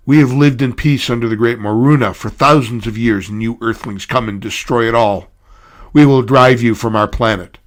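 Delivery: loud rebel leader's proclamation; shouting rebel speech; defiant yell. Acted. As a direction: declaim it to the invaders.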